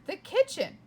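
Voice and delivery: bright, high and loud burst; excited exclaim. A narrator's reading. Narrator thrilled at finding the kitchen. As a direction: announce it with sudden glee.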